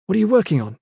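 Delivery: eager. A narrator's reading. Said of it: "Kip asked eagerly".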